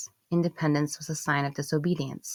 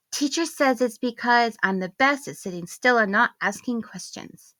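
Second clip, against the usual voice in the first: put-on high, bright child's voice; little girl voice; 7 semitones higher, 3 dB louder than usual. Daughter telling mom from the back seat, childlike voice. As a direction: speak as an eager small daughter. Young daughter speaking in story.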